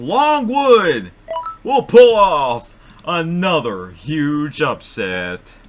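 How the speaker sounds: high, loud, slow hype shout, easing toward the end; excited shouted announcement. Real, not acted. Host announces the wheel's underdog pick, excited drawn-out shout. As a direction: stretch out the big call at full voice. Announcing a huge surprise upset.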